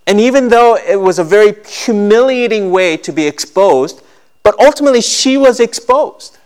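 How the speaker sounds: high, loud preaching voice; impassioned preaching. Acted. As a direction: preach it out to the whole church. Preaching at full force.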